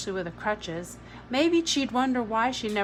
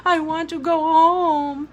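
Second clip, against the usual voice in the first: put-on high tearful boy's wail; sobbing child; 8 semitones higher, 5 dB louder than usual. A narrator's reading. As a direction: keep sobbing through the tantrum. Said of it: "Jamie sobbed".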